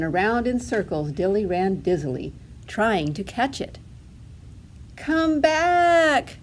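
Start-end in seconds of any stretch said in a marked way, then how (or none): 4.9-6.4 high, loud, stretched call. A narrator's reading. Duckling to her runaway feather, calling out. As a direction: call after something drifting off. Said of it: "she called to her feather".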